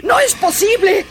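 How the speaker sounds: high, breaking, crying protest; tearful denial. Acted. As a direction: plead through tears that it can't be true. Housekeeper refuses to believe.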